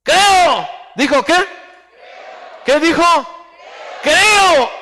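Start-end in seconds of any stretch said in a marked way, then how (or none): 0.0-0.7 high, loud cry of "creo"; acted desperate father calling out "creo". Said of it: "el padre del muchacho clamó y dijo". 4.0-4.7 "creo" shouted high and loud; the father's acted outcry, falling at the end; crying out. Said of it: "el padre del muchacho clamó y dijo".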